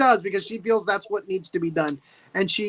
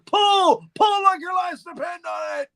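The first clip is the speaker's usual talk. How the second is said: high, shouted hammed-up order; mock urgent command; 10 semitones higher, 4 dB louder, slower than usual. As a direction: holler it high to drive the crew on. Acting out crew commander's order.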